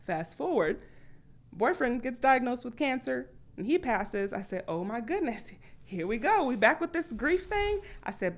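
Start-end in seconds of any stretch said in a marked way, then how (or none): 4.7-7.8 high, exasperated, rising into disbelief; sighing at grief coming back; mock-weary dread; weary here we go. Her reaction to boyfriend's cancer.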